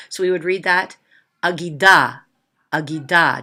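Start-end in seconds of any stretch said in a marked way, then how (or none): 1.4-3.4 "agida" with a very short opening ah; "agida" as a quick ah, then gee-DAH; quick first ah. Shown as a demonstration. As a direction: clip the first vowel of "agida", landing on the long DA. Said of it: "Notice how quick the ah part is".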